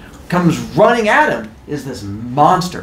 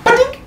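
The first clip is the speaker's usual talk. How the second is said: high-pitched little bonk noise; playful cartoon bonk, pitched way up; bonk sound effect. Goddess bonks him on the head, high bonk noise.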